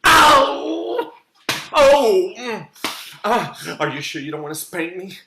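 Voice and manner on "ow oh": high loud yelp of pain; hammed-up yelp. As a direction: squeal at the slap. Spanks himself.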